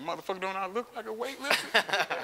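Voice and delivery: high-pitched, mock-outraged retort; indignant comeback. Lifter answering doubting doctors, indignant comeback. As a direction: bat away a dumb question in a high voice.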